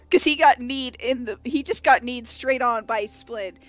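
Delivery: ordinary delivery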